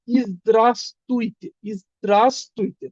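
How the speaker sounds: slow, syllable by syllable "ইজদ্রাস্তুইতে"; careful teacher's "ইজদ্রাস্তুইতে", falling at the end; pronunciation demo. Shown as a demonstration. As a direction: spell out "ইজদ্রাস্তুইতে" slowly for learners. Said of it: "উচ্চারণ হবে কি?".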